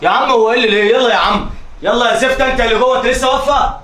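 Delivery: loud, rising shouts; angry shouting. Acted. Husband throwing wife and kids out, shouting. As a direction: drive them out of the house.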